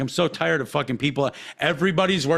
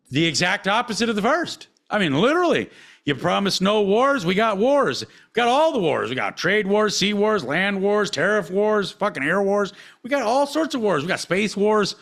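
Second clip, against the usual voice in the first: heated rant; 6 semitones higher than usual. Real, not acted. Voter ranting at the president over broken promises, heated. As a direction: hammer each kind of war harder.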